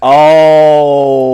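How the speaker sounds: long, loud, high drawn-out cry; thrilled admiration. Real, not acted. Seeing friend's chosen truck colour, loudly thrilled. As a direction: swoon loudly over the sight.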